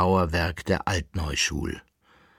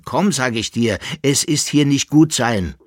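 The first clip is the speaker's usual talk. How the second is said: higher, insistent plea; urgent coaxing; 6 semitones higher, 6 dB louder than usual. A narrator's reading. Jäckele-Narr warns his companion to leave, urgent and insistent. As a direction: urge him away with raised voice. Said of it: "redete der Jäckelnarr auf ihn ein".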